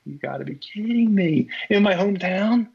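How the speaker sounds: can't believe it just happened; disbelieving groan. Retold or imitated. Pitcher reliving the homer in his hometown, pained disbelief.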